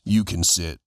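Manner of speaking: low, curt permission; the veteran lets the young fighter sit down; gruff mentor. Retold or imitated. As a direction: grant it flatly, sparing words. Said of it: "He goes".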